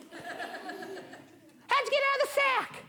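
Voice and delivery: startled squeak. Acted. Wiley startled.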